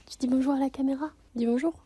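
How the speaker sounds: high sing-song pet talk. Real, not acted. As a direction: coax the pet sweetly. Talking to pet.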